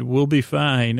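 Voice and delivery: ordinary talk, nothing marked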